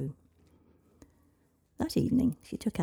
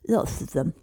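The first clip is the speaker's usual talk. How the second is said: talking through honey cake; mouth full; 8 dB louder than usual. Acted. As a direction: mumble round a mouthful. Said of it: "her mouth full of honey cake".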